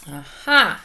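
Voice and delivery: ordinary talk, nothing marked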